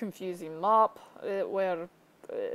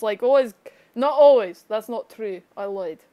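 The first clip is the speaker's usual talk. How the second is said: usual speaking voice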